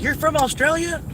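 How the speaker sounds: high, eager voice of a friendly Kentuckian; excited Kentucky local. Retold or imitated. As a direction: play an overjoyed local greeting a visitor.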